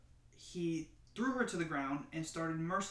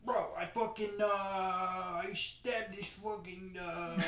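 Staten Island tough guy, breaking into laughter; hammed-up Staten Island Italian bro; mock Staten Island accent; 2 semitones higher, slower than usual. Jokey confession to a friend in a Staten Island accent. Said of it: "See, I can't take it seriously".

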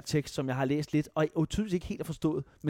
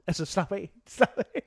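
laughing mock advice; 5 semitones higher, slower than usual. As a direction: tease him to relax, chuckling. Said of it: "jeg fik endda at vide".

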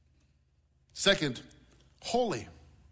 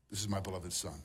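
much softer and lower; tender whisper-soft blessing; 9 semitones lower, 10 dB quieter than usual. Pretend. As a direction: hush it like a loving father. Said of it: "that same voice of the Father speaks over your life".

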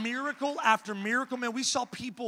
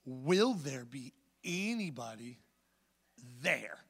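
slow rephrase; 6 semitones lower, 5 dB quieter, slower than usual. Retold or imitated. Slowly rephrasing question to driver.

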